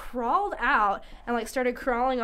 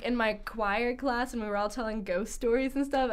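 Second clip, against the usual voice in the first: ordinary delivery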